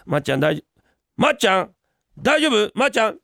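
ordinary delivery

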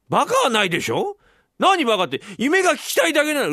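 high, loud, wounded protest; indignant wife. Acted. Wife protesting at her husband's insult, indignant. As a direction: protest the insult, climbing in pitch.

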